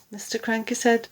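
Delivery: ordinary delivery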